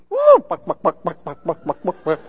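mock the chicken-walk church with clucks; mock chicken clucking. Preacher mocking weird worshippers with a whoop and clucking.

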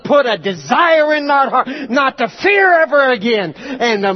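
loud, high praying; fervent prayer. Acted. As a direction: pray over the congregation with fire. Praying over the congregation loudly.

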